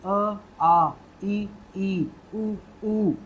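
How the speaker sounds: short अ, long आ, short ई then long ई; teacher models Hindi vowel lengths; vowel recitation. Shown as a demonstration. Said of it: "ध्वनि पे खास तौर पे ध्यान दीजिएगा".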